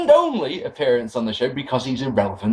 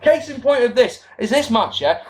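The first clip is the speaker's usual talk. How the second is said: ordinary talk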